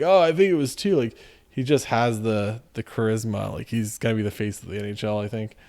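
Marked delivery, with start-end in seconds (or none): 0.0-1.0 imitating Zegras joking. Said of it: "He's like".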